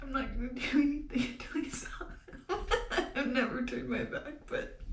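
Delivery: high voice breaking into teary laughter; tearful laughing. Real, not acted. Recalling her vow to God, laughing through tears.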